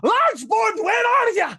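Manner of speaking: wife yells for Largeboard as she storms off, furious; distant furious yell. Acted. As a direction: bellow for him from the next room. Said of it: "you just hear".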